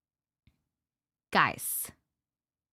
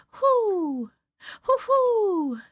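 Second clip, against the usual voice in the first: owl hoots; 7 semitones higher, much slower than usual. Retold or imitated. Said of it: "The something owl says".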